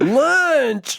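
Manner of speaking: very high Southern squeal; mock Southern accent. Host mimicking his co-host, in a high Southern accent. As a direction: stretch "Lunch" in a mimicked twang.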